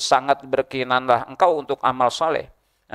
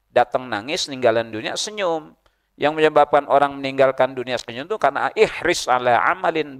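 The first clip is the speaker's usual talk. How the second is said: normal talking voice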